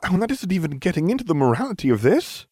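high-pitched indignant protest; crying out. A narrator's reading. Hermione objects on moral grounds, crying out. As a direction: keep protesting, voice pitched right up. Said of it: "she cried".

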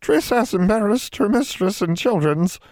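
very high elf voice, tearful; a tiny elf confessing her shame; ashamed house elf. A narrator's reading. Elf apologising to her mistress, tearful.

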